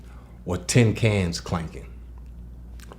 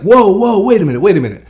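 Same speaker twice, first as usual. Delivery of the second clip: much higher, louder startled whoa; excited whoa; 10 semitones higher, 9 dB louder, faster than usual. Retold or imitated. Hearing Margie describe his dog, loud and startled. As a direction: blurt it out loud in shock. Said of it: "I was like".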